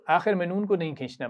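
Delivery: usual speaking voice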